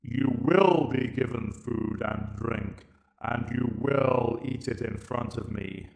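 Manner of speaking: slow, cold alien verdict; Dalek Emperor. Acted. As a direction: judge him like an unfeeling machine ruler. Emperor commands.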